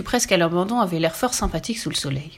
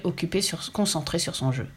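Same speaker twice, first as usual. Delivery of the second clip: plain reading voice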